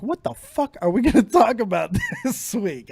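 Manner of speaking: exasperated mock panic, laughing midway; freaking out. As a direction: act out a comic freak-out. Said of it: "I was freaking out all week".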